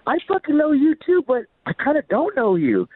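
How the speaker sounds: high-pitched re-enacted enthusiasm; excited reply. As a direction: fire back the greeting, high and delighted. Acts out own excited reply.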